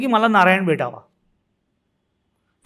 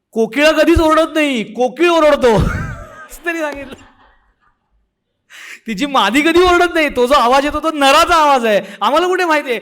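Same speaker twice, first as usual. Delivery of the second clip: indignant retort; 4 semitones higher than usual. Retold or imitated. Seller mocks the woman's vow.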